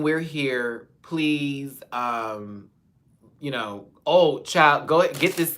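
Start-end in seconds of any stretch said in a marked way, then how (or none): 4.1-5.6 dramatic plea. Pretend. Dramatic appeal to Alicia.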